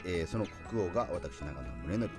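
ordinary talk, nothing marked

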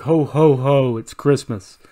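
loud jolly ho ho ho, drawn out; loud mock Santa laugh; hammed-up Santa. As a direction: boom it like Santa. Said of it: "He's like".

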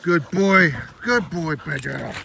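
loud, high praise for the dog; excited dog praise. Real, not acted. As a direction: reward the dog loudly. Praising dog after hold.

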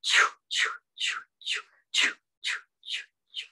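whispery, rhythmic choo puffs; imitated engine, all breath; steam train sound. Shown as a demonstration. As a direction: chug like wheels gathering steam. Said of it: "let's get those wheels going".

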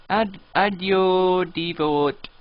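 slow, drawn-out mock Italian accent; attempted foreign accent. Gamer horrible with accents trying one, dubious Italian accent. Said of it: "That was Italian?".

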